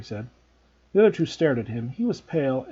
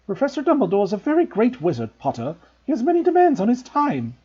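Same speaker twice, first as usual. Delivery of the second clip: lightly raised stern teacher; a crisp, stern Scottish-tinged professor; strict old witch scolding a pupil; McGonagall; 9 semitones higher, 4 dB louder than usual. A narrator's reading.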